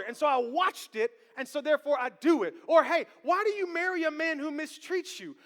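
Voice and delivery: loud, high-pitched sermon delivery pounding the questions; preaching at full force. Real, not acted. Pastor challenging the congregation about routine, full-force preaching. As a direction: preach it, hammer every why. Preacher drives home the point.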